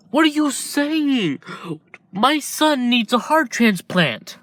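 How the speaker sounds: a mother refusing to accept no; desperate outrage. Acted. Inko pleading with the doctor, high desperate outrage.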